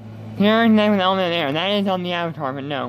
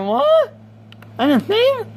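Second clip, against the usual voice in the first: shocked disbelief; much slower than usual. Real, not acted. YouTuber reacting to Akinator's guess, squeaking in shock. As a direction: blurt it out in stunned disbelief.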